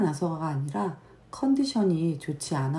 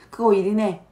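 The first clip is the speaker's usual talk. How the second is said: louder, higher stern rebuke; stern scolding; 5 semitones higher, 7 dB louder than usual. Retold or imitated. Mom taking the toy away, loud and stern. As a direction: lay down the law over the toy. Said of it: "하면서, 예, 이야기를 하거든요".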